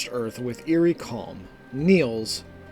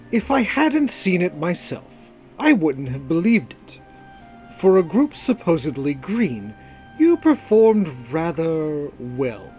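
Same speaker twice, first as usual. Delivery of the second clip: Calor; 3 semitones higher, 3 dB louder than usual. A narrator's reading. Calor praising a green group, measured condescension.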